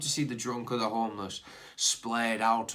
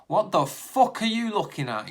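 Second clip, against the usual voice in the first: put-on slurred, louder drunk snarl; drunken slur; 9 semitones higher, 10 dB louder than usual. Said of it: "he slurred".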